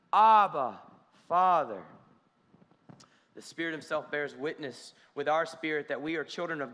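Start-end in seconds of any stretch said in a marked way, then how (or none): 0.1-1.8 loud cry Abba. Acted. Scripture cry read aloud.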